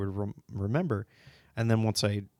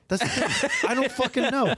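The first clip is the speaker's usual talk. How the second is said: high giggling words; laughing; 13 semitones higher than usual. Real, not acted. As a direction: laugh through the line. Laughing about the sister.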